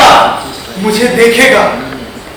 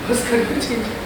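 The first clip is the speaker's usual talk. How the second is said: barely audible, much softer; hushed echo; 2 semitones lower, 14 dB quieter than usual. Acted. Artist echoes people's dismissal of his loss, hushed. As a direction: repeat it very quietly, as if to yourself.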